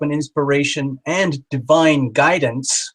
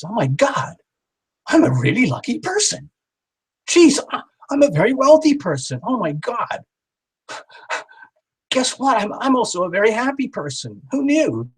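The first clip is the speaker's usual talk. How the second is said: much higher, amazed, as if hearing it for the first time; mock surprise; 8 semitones higher than usual. Shown as a demonstration. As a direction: gasp out each line like startling news. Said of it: "And then I kinda go in surprise".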